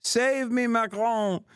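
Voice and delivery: very high pleading French-accented wail, hammed up; mocking French accent. The fallen protester begging Macron for rescue, in a very high mock French accent. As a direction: beg Macron in a squeaky, overdone French accent. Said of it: "Wasn't that the, the French Beitar?".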